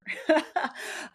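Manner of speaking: a short breathy laugh, pitched high. Real, not acted. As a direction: laugh it off lightly, almost silent. Awkward laugh mid-story.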